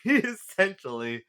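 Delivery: laughing. Real, not acted. Laughing at own impression.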